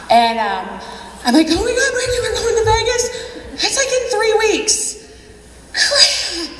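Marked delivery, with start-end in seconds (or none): 1.5-6.5 high, drawn-out excited squeal of disbelief; excited panic. Retold or imitated. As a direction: gush high and slow, giddy with nerves. Retold excitement about going to Vegas.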